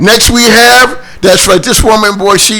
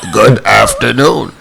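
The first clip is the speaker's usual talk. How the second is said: radio host voice; 10 semitones lower than usual. Retold or imitated. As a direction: imitate the guest's deep on-air greeting. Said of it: "He sound like a radio host".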